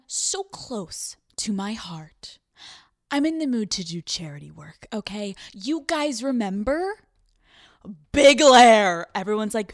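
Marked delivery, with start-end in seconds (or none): 0.1-2.4 whisper. Acted. Tender aside about a friend. 8.1-9.1 loud boxing-ring style introduction; loud, proud nickname call-out; announcing a nickname. Acted.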